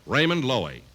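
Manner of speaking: ordinary talk, nothing marked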